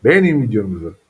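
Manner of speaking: speaking up for the camera; loud and clear. Shown as a demonstration. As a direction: project it loudly, showing how. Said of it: "Biraz yüksek sesle konuş".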